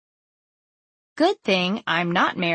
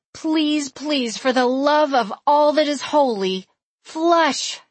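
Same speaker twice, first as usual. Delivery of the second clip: high, desperate begging; pleading; 6 semitones higher than usual. Retold or imitated. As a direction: plead with the toilet, pitched up. Begging the toilet at 3 AM.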